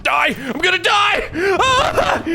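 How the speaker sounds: building from tense to high shrieking yell; cornered by the monster, screaming; panicked scream. Real, not acted. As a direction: scream it as panic takes over.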